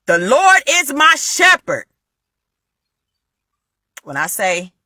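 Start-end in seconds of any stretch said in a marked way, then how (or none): 0.1-1.8 high, slow, ringing declaration of scripture; reenacted loud, commanding prayer; loud, with conviction. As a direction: proclaim the verse out loud, drawing it out. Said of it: "I'm talking about so loud".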